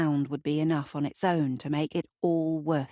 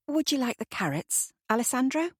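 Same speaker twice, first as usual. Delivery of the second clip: a hint of shyness, higher; shy; 8 semitones higher than usual. A narrator's reading. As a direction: ask timidly, a little higher than usual. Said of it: "with uncharacteristic shyness".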